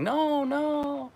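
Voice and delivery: high, soft falsetto no; playful fake refusal; coy girl imitation. Imitating a smiling girl saying no, high and soft. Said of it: "kind of smiling".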